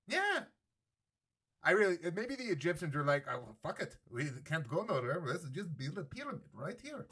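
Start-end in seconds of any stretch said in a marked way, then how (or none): 3.3-7.1 put-on weird Persian-Egyptian accent, quiet; a clumsy Middle Eastern accent. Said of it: "That's a weird Persian, Egyptian type accent".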